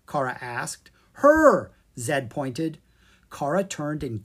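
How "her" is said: high, loud cry of discovery; excited point. Acted. Zed pointing out the princess to Kara, loud and excited. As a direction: burst out as you point.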